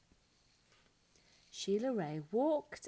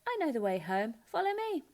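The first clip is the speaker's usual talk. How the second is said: confident sister; 7 semitones higher, 4 dB louder, faster than usual. A narrator's reading. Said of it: "said Louise".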